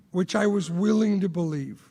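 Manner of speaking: ordinary talk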